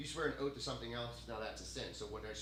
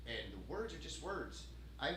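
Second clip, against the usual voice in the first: ordinary talk, nothing marked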